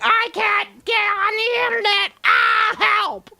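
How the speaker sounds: very high, drawn-out wail for help; hammed-up helpless caller in a panic; helpless mock wail. CEO calling head of IT, mock helpless wail. Mocks the CEO's helpless IT call.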